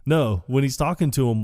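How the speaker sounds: normal talking voice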